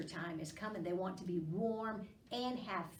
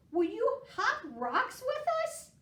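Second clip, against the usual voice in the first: higher, louder frog asking; 13 semitones higher, 6 dB louder than usual. A narrator's reading. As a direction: ask like a bouncy frog. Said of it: "said Frog".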